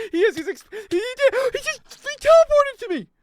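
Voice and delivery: very high, loud startled cry; shocked shriek. Real, not acted. Gamer shocked as an enemy teleports to him, high shriek. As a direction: squeal it in disbelief.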